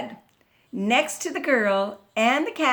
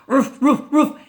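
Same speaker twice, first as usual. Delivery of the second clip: dog barking; 3 semitones higher, 7 dB louder than usual. A narrator's reading. Dog barks at the squeaky bed, loud woofs. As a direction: bark it out sharply like a dog. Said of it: "and the dog".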